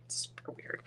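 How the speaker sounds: unvoiced whispered aside. Real, not acted. Whispering an aside to the viewers while crafting. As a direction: breathe it like a secret. Aside after showing dog's knock.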